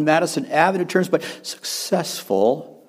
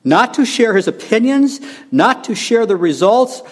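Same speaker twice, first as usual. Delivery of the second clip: emphatic quote reading, climbing higher after the opening; Machen; 4 semitones higher than usual. Retold or imitated. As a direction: read it out with rising, forceful emphasis. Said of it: "Machen said this".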